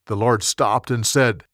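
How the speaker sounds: plain reading voice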